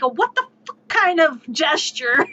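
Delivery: normal talking voice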